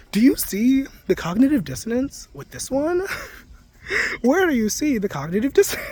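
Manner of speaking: put-on high, chirpy kids-show host asking the audience, laughing; Dora-style host. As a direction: play a cheerful cartoon host quizzing the viewers. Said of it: "a Dora style show where they pointed out".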